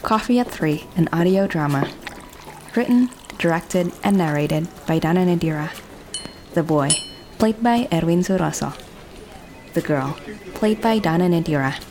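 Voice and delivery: calm announcer-style credit read. A narrator's reading. Narrator reading the closing credits of the audio drama, calm.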